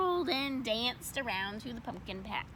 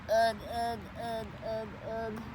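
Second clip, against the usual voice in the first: grunts. Acted. Carrying heavy pumpkin.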